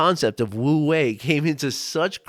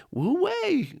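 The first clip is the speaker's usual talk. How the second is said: self-reminder. Retold or imitated. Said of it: "I actually do repeat that".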